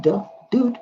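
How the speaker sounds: ordinary talk, nothing marked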